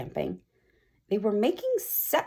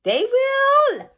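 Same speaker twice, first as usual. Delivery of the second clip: shrill high cries of help; mock yelling for help; 5 dB louder than usual. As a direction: squeal it like panicked teachers. Witch gloats.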